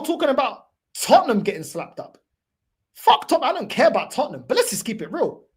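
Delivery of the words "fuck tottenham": louder, higher burst of anger; angry outburst. Real, not acted. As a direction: spit it out in frustration. Angry rant about rival fans.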